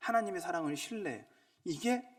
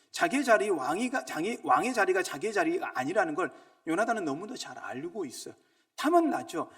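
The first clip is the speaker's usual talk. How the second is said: loud, high, insistent sermon cry; fervent preacher proclaiming; preaching at full force; 5 semitones higher, 8 dB louder than usual. Acted. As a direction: drive it home to the congregation.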